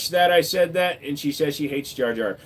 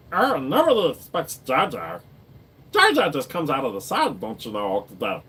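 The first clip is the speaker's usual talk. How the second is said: Jar Jar voice. Pretend. Continues Jar Jar voice.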